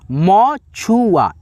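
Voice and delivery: very slow, stretched-out word; teacher modeling मछुआ slowly; drawn out. Shown as a demonstration. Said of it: "teacher models the word slowly".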